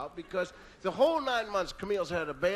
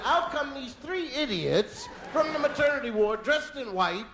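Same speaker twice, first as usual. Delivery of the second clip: ordinary talk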